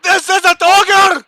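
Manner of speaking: shouting. Acted. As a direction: yell it while being dragged. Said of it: "I shout".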